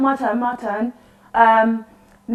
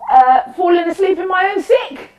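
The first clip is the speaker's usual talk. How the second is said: rowdy shout; 6 semitones higher, 4 dB louder than usual. Acted. Drunk game getting rowdy.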